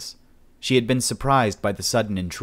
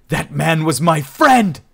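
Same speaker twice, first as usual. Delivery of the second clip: high, loud furious yell; enraged shout over a destroyed statue; raging shout; 8 semitones higher, 10 dB louder, slower than usual. A narrator's reading. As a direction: scream it at him in outrage. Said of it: "Cheer shouted in his rage".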